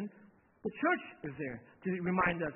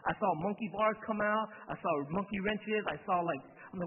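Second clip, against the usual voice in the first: usual speaking voice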